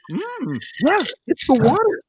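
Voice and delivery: high, delighted mm, yeah; impressed. Shown as a demonstration. Beer drinker savoring it, high and pleased. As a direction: sound genuinely impressed by the beer. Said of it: "it can go either way".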